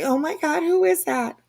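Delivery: high-pitched startled disbelief; retold alarm. Host telling viewers about first hearing the voice, startled. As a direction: relive the shock, pitch jumping up. Retelling first reaction to strange voice.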